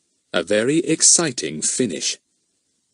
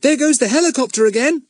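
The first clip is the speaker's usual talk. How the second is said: high, louder sudden call; sudden exclamation; 5 dB louder than usual. A narrator's reading. Pip spots the helicopter, a sudden high call. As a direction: blurt it out all at once, higher. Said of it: "said Pip suddenly".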